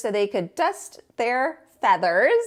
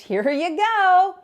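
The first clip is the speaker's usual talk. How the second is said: higher, louder playful call; squirting with glee; gleeful Tacky; 7 semitones higher, 6 dB louder, slower than usual. Acted.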